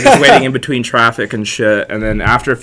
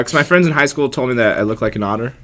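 ordinary delivery

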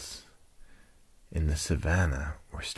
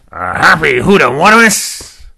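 put-on loud, incredulous old rhino outburst, pitched up; Rex; 10 semitones higher, 15 dB louder than usual. Grumpy rhino mishearing happy hippo, loud baffled voice. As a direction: blurt it out, garbling the animal's name. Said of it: "grumpy old cantankerous rhinoceros".

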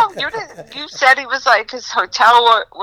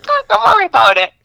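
breezy high; 12 semitones higher, faster than usual. Retold or imitated. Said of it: "I'm like".

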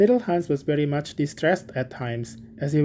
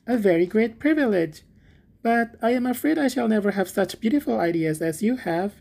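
higher-pitched, admiring little Hans; humble little Hans; 7 semitones higher than usual. A narrator's reading. Hans admires the miller's talk on friendship, humble. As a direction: flatter humbly in a high character voice.

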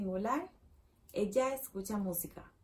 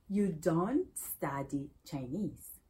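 slow, careful model sentence; 4 semitones lower, much slower than usual. Shown as a demonstration. Teacher slowly models the sentence for pupils, careful. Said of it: "slow model sentence".

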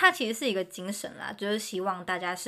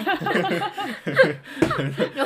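laugh; 6 semitones higher, 7 dB louder than usual. Real, not acted. Laughing with friends.

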